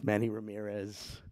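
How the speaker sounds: ordinary talk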